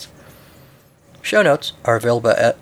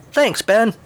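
high-pitched, eager reply; chipper thanks; 11 semitones higher, 3 dB louder than usual. Acted. Young pilot thanking his mentor, high and chipper. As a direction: answer brightly, pitch jumping up. Luke gratefully answers Ben.

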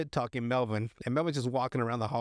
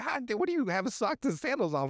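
high, baffled outburst; mock disbelief; 8 semitones higher than usual. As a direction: question it in a raised, incredulous voice. Acted disbelief at the odd sight.

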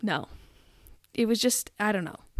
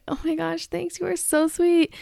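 high-pitched fake gratitude; mock gushing wife; 7 semitones higher than usual. Wife thanking the husband filming her, mock gushing. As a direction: gush sweetly in imitation. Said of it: "And they're like".

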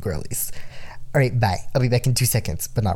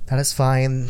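ordinary delivery

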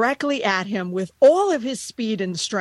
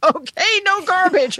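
mock firm owner; 10 semitones higher, 6 dB louder than usual. Voicing herself to the puppy.

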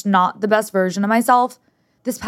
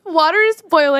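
high, laughing echo of the warning; laughing mock panic; 8 semitones higher than usual. Echoing her partner's kitchen warning, laughing and high. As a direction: squeal it back through a laugh.

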